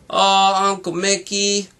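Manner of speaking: put-on whiny high "Aw" of a boy who doesn't want to stop; protesting. Morty protests to Uncle Mickey about resting, whiny. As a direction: complain like a kid sent to bed. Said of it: "Morty protested".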